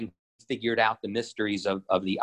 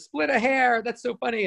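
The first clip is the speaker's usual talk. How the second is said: much higher, giggly witch voice repeating the joke; tickled by her own pun, high and cackly; amused witch; 12 semitones higher than usual. Retold or imitated. Witch laughing at her own joke, high mock cackle. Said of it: "And she, like, cracks up".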